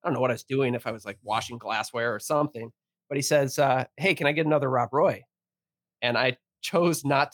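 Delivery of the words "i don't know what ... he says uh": ordinary delivery, nothing marked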